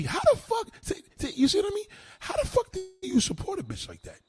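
very high-pitched, outraged disbelief; incredulous squeal. Real, not acted. Incredulous challenge to a commenter.

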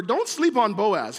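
usual speaking voice

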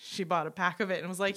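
ordinary talk, nothing marked

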